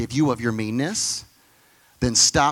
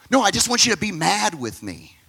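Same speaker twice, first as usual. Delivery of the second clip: mock whiny wife; 8 semitones higher, 4 dB louder than usual. Imitating complaining wife.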